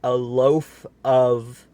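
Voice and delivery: loud, slow bellow cut off; top-of-voice shout. Acted. Mister Noisy bellows at Missus Crumb at full volume. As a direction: bellow it as loud as you can. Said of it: "shouting at the very top of his voice".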